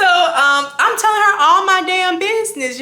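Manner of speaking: usual speaking voice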